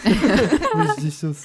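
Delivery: slow, high put-on French accent, laughing; mock French chef asking for sauce, drawn out; French accent hammed up, very slow; Manu impression. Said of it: "impressions of Manu".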